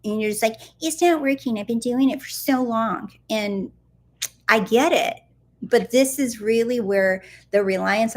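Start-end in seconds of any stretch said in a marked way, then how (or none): 0.8-3.1 high-pitched imitation of a complainer; lightly mock a frustrated whine, starting high; mock complaining. Coach imitating a viewer whose manifesting isn't working, mock complaining.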